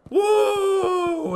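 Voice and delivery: loud high woo; woo cheer. Real, not acted. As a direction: let out a big excited whoop. Cheering excitedly.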